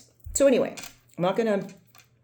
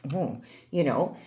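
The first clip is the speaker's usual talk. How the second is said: ordinary talk, nothing marked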